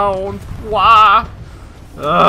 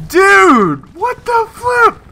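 exasperated outburst; 14 semitones higher, 6 dB louder, much slower than usual. Real, not acted. Frustrated at pilot sentries.

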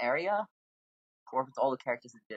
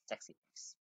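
breathy whispered aside; whispering; 13 dB quieter than usual. Real, not acted. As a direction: whisper it to yourself. Muttered reaction to character.

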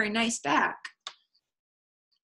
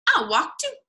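high little bunny voice; high-pitched animal character; friendly Rabbit; 11 semitones higher, 3 dB louder than usual. A narrator's reading. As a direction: chime in high, like an eager rabbit. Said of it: "said Rabbit".

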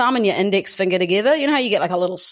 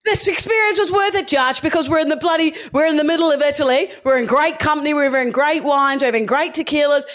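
heated shout; 6 semitones higher, faster than usual. Real, not acted. Friend shouting back at Josh during the argument, heated.

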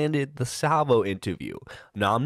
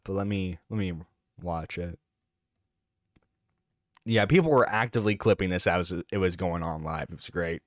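normal talking voice

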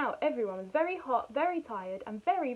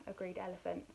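usual speaking voice

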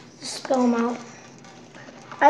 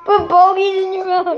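teasing shout; 5 semitones higher, 3 dB louder than usual. Real, not acted.

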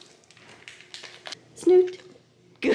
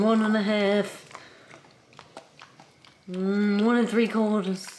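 chewing while counting; slower than usual. Pretend. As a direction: mouth full of food.

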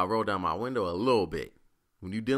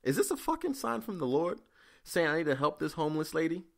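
much higher, wondering inner voice; 8 semitones higher than usual. Retold or imitated. Thinking it's a sign from the Lord, high amazed. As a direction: marvel as if hearing from above. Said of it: "In my head, I'm like".

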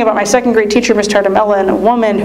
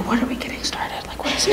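whispered, much softer, puzzled; nervous whisper; 11 dB quieter than usual. Retold or imitated. Nervous kid answering the deacon.

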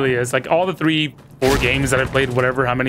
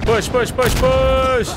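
urgent shouting; 11 semitones higher, much slower than usual. Real, not acted. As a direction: yell at the team to push. Urging team to push.

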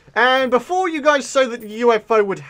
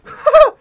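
delighted laugh; 12 dB louder than usual. Real, not acted. Excited laugh at achievement.

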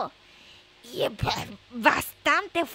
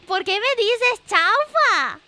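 louder, slower, offended; indignant protest; 3 semitones higher, 7 dB louder, slower than usual. Real, not acted. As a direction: push back, playfully offended. Indignant at being called chaufa. (protesta indignada)

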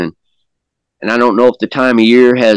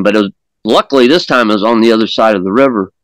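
usual speaking voice

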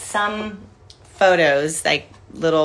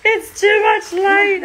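high, loud, drawn-out complaint; mock protest; 13 semitones higher, 9 dB louder, slower than usual. Joking to the helper who switched on a light, hammed-up protest. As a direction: wail it out in exaggerated protest.

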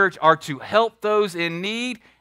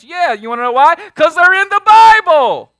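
defiant pulpit shout; shouted declaration; 10 semitones higher, 13 dB louder than usual. Acted. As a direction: shout it out as undeniable proof. Preacher answers critics defiantly.

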